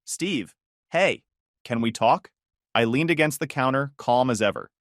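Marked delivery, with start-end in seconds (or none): 0.0-2.3 shaky, holding back tears. A narrator's reading. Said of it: "Her voice came through shaky, like someone trying not to cry".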